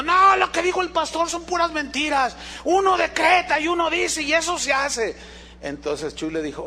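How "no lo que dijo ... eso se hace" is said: voz de joven más aguda y tajante; joven que contradice. Retold or imitated. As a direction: imitar a un joven que reclama al pastor. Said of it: "se acercó un joven diciendo". (imitación de un joven que llama mentiroso al pastor)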